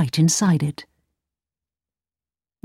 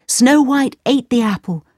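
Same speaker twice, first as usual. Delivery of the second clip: plain reading voice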